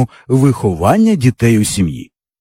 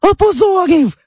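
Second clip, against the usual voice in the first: sudden high shrill-free yell, much higher; little girl's shout. A narrator's reading. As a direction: burst out yelling like a wronged little sister. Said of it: "раптом як закричить".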